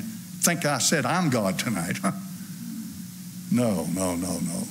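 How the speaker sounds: normal talking voice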